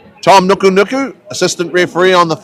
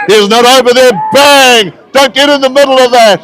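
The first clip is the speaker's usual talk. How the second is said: louder and higher, rising excitement at a big hit; excited commentary; 5 semitones higher, 3 dB louder than usual. Real, not acted. Commentator reacting to a big hit, excited. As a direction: call it like a crunching tackle just landed.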